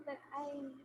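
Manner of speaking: very soft, far from the mic; speaking too quietly; barely audible. Real, not acted. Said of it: "your voice is very low".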